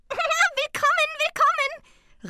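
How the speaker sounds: very high, loud, drawn-out welcome after a giggle; giggle, then squeal a slow greeting; rufendes Tingeli. A narrator's reading. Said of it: "rief es". (kichernd, hoch und laut gerufen)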